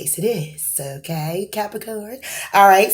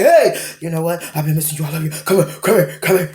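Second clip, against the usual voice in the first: too excited; faster than usual. Retold or imitated. Someone holding back imagines gushing to their love, mild mock excitement. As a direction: let the eagerness spill out, lightly hammed. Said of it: "I can't get too excited and be like".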